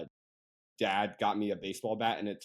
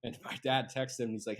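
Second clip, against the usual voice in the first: usual speaking voice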